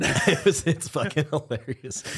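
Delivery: words squeezed out through laughter; can't get it out for laughing; laughing hard. Real, not acted. Telling a friend about the shoving dad, laughing. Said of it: "literally crying right now".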